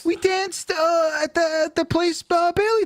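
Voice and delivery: squeaky high disbelief; high, baffled protest; incredulous. Acted. Incredulous high-pitched banter.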